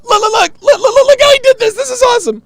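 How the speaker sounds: high, loud squealing imitation of an excited friend; mock excited squeal. Streamer imitating Kevin squealing at a clip. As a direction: shriek it like an overexcited buddy.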